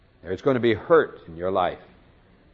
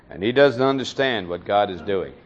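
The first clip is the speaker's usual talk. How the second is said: normal talking voice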